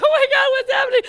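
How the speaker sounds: loud, very high panicked cry; child panicking as her father stares at the blood. Retold or imitated. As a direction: freak out all at once. Kid panicking, laughing retelling.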